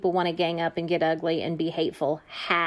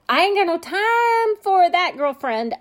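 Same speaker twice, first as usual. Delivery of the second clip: sassy dismissal; 10 semitones higher, 5 dB louder than usual. Acted. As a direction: play up a sassy refusal to a girlfriend. Sassy acted dismissal of trolls.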